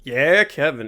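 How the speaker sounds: normal talking voice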